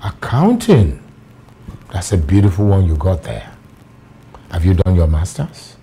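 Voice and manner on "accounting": high, loud echo of surprise; impressed surprise. Acted. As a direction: repeat it as a pleased exclamation. Boss reacts to the field studied.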